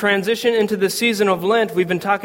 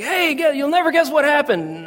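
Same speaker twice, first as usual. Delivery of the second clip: imitating an excited dad, pitched way up; 9 semitones higher than usual. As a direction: burst out with big news in a high voice. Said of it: "And he's excited".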